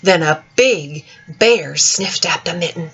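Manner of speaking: usual speaking voice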